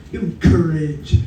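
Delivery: ordinary talk, nothing marked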